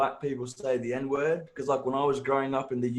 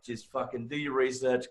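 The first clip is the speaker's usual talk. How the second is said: ordinary talk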